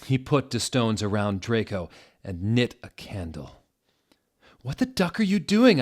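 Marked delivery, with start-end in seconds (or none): 4.6-5.8 much higher, louder outraged yell; hammed-up furious shout; angry shout. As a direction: scream it at him. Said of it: "I shooted angrily".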